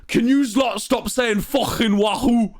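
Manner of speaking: high, exasperated Scouse impression; angry Scouse deskman. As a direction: imitate a fed-up Scouse concierge. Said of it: "the Liverpudlian, like, Scouse man at the desk".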